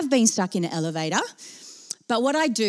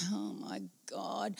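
very soft, slow sigh of disbelief; exasperated undertone; 2 semitones lower, 19 dB quieter, slower than usual. Retold or imitated. Colleague behind the flowers watching her panic, hushed and exasperated. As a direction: breathe it out wearily, barely audible.

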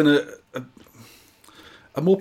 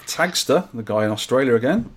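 ordinary delivery

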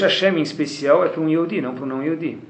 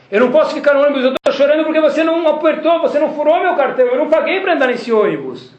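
put-on a crying boy's voice pitched up, pleading; high pleading child; 11 semitones higher, 4 dB louder than usual. A boy tells the bus driver he didn't pay, pleading. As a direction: plead like a tearful little boy.

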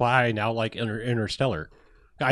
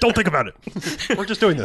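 mock brush-off; 9 semitones higher, faster than usual. Co-host joins as the dismissive movie.

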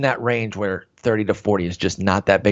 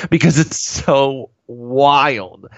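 laughing; 4 semitones higher, much slower than usual. Real, not acted. Laughing at wild stat line.

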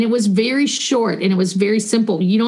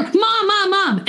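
put-on much higher, insistent child's call to mom; child calling more insistently; 9 semitones higher, slower than usual. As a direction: imitate a kid calling mom again, more urgently when ignored. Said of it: "getting louder and louder".

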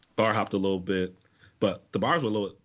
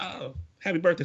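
cheery staff voice; 10 semitones higher than usual. Retold or imitated. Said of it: "They was like".

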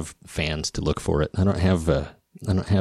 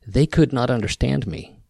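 ordinary talk

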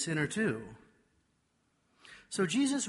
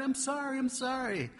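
higher, pleading call; pleading yell; 9 semitones higher than usual. Retold or imitated. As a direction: call it out pleading while running.

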